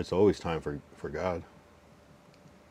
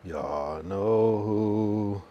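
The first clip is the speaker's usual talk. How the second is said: drawn-out catchphrase; much slower than usual. Pretend. Stretched tag line.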